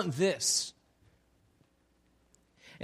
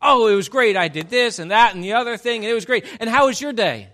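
put-on loud, bubbly over-the-top teenager; mock gushing teen; 3 semitones higher, 8 dB louder than usual. Pastor mocking a teenager's imagined chatty answer, loud and gushing. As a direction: gush like an improbably chatty teen. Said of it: "a teenager's response is".

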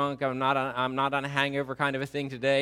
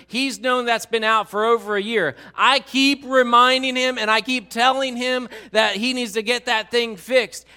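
high, loud nagging complaint; exasperated spouse piling on the grievances; annoyed wife; 6 semitones higher, 7 dB louder than usual. Acted. Wife complains to officer about broken taillight, loudly annoyed. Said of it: "he is really annoying".

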